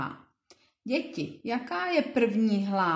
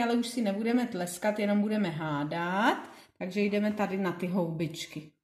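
ordinary talk

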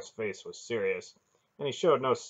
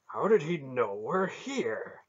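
higher, louder, slowed-down challenge; demanding; 6 semitones higher, 5 dB louder, slower than usual. A narrator's reading. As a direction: demand an explanation, pitch raised. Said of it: "Beric demanded".

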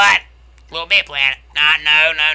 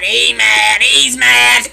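high, loud teasing chant; loud taunting; 8 semitones higher, 7 dB louder, slower than usual. Real, not acted. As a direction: crow it mockingly, pitched way up. Gleeful taunting of angry player.

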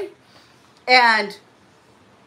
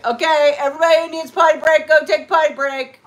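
calling out; 6 semitones higher, 5 dB louder, faster than usual. Real, not acted. Calling everyone for break.

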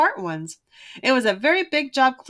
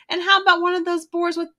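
Sister asking; 6 semitones higher than usual. A narrator's reading. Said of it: "asked Sister".

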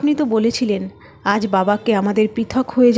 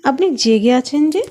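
mildly surprised question, pitched higher; 5 semitones higher than usual. A narrator's reading. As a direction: ask in mild astonishment, voice lifting. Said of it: "অবাক হয়ে জিজ্ঞেস করলো". (অবাক হয়ে, একটু উঁচু সুরে প্রশ্ন)

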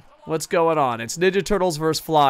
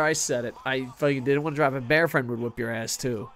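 ordinary delivery

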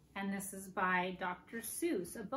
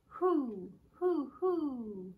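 higher hooting owl calls; owl hoots; 4 semitones higher, 3 dB louder, much slower than usual. Acted. Teacher hoots like an owl for the kids. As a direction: hoot like an owl in a tree. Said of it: "What does an owl say?".

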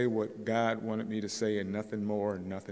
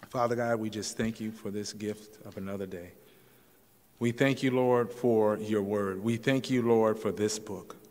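lower, earnest prayer voice; closing prayer; 3 semitones lower than usual. Acted. Pastor leads the congregation in prayer, earnest and low. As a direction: pray sincerely for the church.

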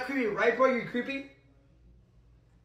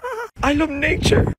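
usual speaking voice

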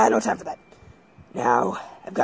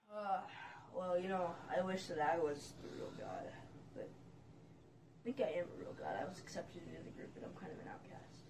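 muttered confession; 20 dB quieter than usual. Acted. Rambling confession.

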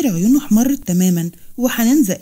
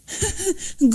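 short high mocking laugh, soft; 9 semitones higher, 6 dB quieter than usual. A narrator's reading. Hanan laughing at Manar's reading, mocking laugh. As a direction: laugh at her scornfully. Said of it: "حنان ضحكت وقالت".

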